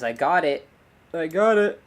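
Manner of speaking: loud, high triumphant cheer; celebrating the fix; real excited shout. Real, not acted. Triumph after camera trouble.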